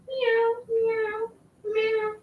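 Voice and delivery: repeated high meows, soft; cat meow imitation.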